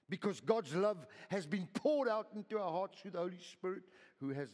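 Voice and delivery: loud high proclamation; preaching at full force. Acted. Pastor proclaims hope to the congregation, loud and high. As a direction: drive every word home.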